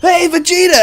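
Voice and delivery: high, loud anime hero call; Goku. Acted. Goku crashing through the set, loud high impression. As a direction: burst in with a hammed-up high voice. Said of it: "And Goku's like".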